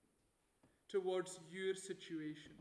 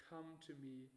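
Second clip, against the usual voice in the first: tender invitation; 6 semitones lower, 11 dB quieter than usual. Acted. God's gentle words quoted.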